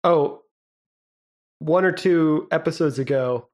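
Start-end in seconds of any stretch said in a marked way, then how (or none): none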